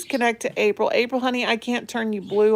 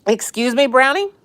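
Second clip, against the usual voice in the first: higher, louder playful "excuse me" to the dog; mock scolding the dog; 9 semitones higher, 5 dB louder than usual. Real, not acted. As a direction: pretend to be offended by the grumbling dog. Owner teasing her griping dog again.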